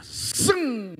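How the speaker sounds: whoosh sound. Retold or imitated. Coaster dropping.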